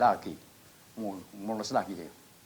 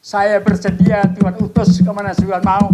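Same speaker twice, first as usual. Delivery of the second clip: doa berseru; 9 semitones higher, 16 dB louder than usual. Retold or imitated. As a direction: shout a full-force vow in prayer. Seruan doa berserah kepada Tuhan. (seruan doa lantang, tinggi, dan panjang)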